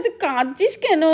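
ordinary delivery

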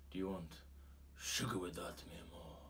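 put-on soft, flirtatious Spanish accent; sexy Spanish accent. Sexy barista flirts with a flustered customer, soft Spanish accent. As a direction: purr it low in a Spanish accent, trailing off. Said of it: "as sexy as a Spanish accent".

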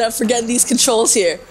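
ordinary delivery

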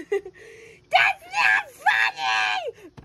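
high, very slow tearful shout; sobbing child's complaint; crying protest. Acted. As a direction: wail it through tears. Upset kid being laughed at.

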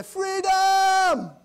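long, drawn-out loud high shout; movie battle cry. Acted. Preacher quoting a favorite film line to the congregation, drawn-out battle cry. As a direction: bellow it like a film hero. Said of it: "la réplique que je préfère de tous les films".

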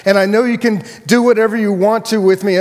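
high, urgent plea to a king; begging. Acted. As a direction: plead desperately, pitched well up. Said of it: "he begs the king".

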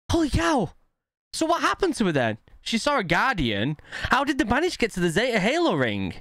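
ordinary talk

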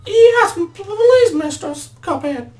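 loud, squeaky falsetto pleading; Cuphead. Acted. Frightened brother begs the devil, high loud cartoon voice. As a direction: plead shrilly high, stumbling over the words. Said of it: "I did that in Cuphead's voice".

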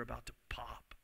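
voiceless whisper; hushed aside. Acted. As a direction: breathe it out in a hush. Whispered line among sermon points.

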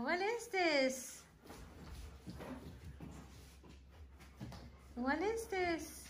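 slow high disbelief. Real, not acted. Baffled by the dog.

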